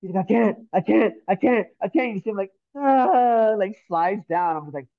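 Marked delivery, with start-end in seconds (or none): 0.2-2.1 higher-pitched, imitated panic; panicked pleading. Friend panicking, slipping from ledge. 2.8-3.5 high, loud imitated yelp while sliding; mock yelp. Friend slides down wall.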